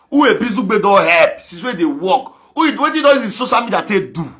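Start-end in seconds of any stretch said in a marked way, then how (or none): 0.1-1.4 louder, higher repeat of the jab; indignant repeated challenge. Real, not acted. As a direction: hammer the question at critics. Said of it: "work, work, who a busy gbe don help?".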